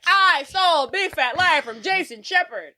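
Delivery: high, slow, drawn-out boast; gloating proclamation. Retold or imitated. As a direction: proclaim it like a taunting villain. Villain's gloating confession acted out.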